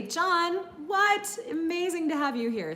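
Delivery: high, loud, thrilled disbelief; excited squeal of surprise easing into welcome; amazed delight. Real, not acted. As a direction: greet a far-off guest in happy astonishment. Amazed at viewer from Chile.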